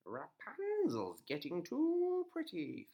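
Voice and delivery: high, slow witch; scheming old witch. A narrator's reading. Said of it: "thought the witch".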